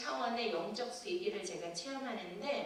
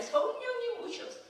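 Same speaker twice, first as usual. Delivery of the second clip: fervent plea, much higher and louder; pastor's earnest prayer; 5 dB louder than usual. Retold or imitated. The pastor prays over her, fervent, high and loud. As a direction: call down the Spirit earnestly. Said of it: "기도를 하신 거예요".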